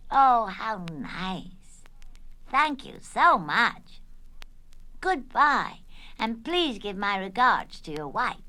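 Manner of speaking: slow, loud, drawn-out pleasantries; gushing politeness. Acted. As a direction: lay on the gracious manners, stretching each phrase. Linda greets imaginary Magic Man.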